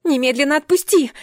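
high, loud command while struggling; yelling. A narrator's reading. Heroine struggling in guard's grip, yelling. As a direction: shout it as if wrenching free. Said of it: "заорала я, безуспешно пытаясь высвободиться".

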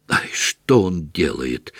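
half-voiced alarm at the fireman; lightly voiced cry. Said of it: "крикнул он". (мягко сыгранный испуганный возглас)

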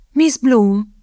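put-on high little-girl voice; shy young lady, pitched high; high girlish voice. A narrator's reading. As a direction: introduce yourself in a high, girlish tone. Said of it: "magas, kislányos hangon".

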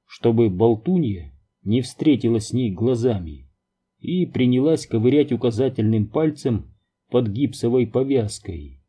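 usual speaking voice

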